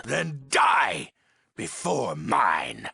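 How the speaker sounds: high, forceful villain yell; shouted death threat. Acted. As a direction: burst into a shout to condemn them.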